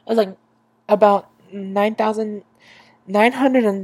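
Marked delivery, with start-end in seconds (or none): none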